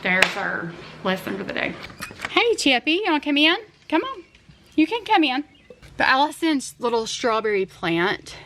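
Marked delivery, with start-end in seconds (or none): 2.3-5.4 high, sing-song voice calling the dog; coaxing a pet. Real, not acted. Mom calling Chippy the dog inside, high coaxing pet voice. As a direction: coax the dog in, pitch raised.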